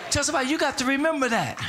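rising fervent preaching call; fervent preacher call. Real, not acted. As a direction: urge the church to call it back, full of fire. Preacher urges congregation, rising fervor.

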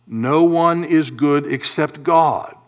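slow, weighty, drawn-out words; said slowly. Shown as a demonstration. Jesus lobbing the answer to the ruler, said slowly. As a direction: stretch each word so he can't miss it. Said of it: "I'll say it slowly".

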